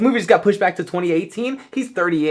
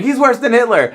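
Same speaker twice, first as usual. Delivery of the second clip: higher, louder mock accusation; mock exaggeration; 9 semitones higher, 5 dB louder than usual. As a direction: overstate it for laughs. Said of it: "maybe I'm exaggerating a little bit".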